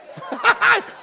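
high-pitched burst of laughter; high sheepish cackle. Real, not acted. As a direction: let out an embarrassed laugh. Laughs at his own pride.

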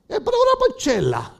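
very high, imitated local woman. Guatemalan woman gives a lost driver a sign, imitated high-pitched speech. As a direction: squeal the garbled direction in falsetto. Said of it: "ella habló como habló".

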